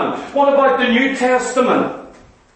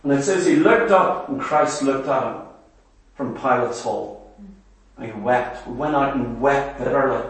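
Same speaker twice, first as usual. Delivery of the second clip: hushed, almost to a murmur, as he weeps; hushed retelling; 11 semitones lower, 6 dB quieter than usual. A narrator's reading. Preacher to congregation on Peter's weeping, hushed and low. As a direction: drop low and soft for Peter's tears.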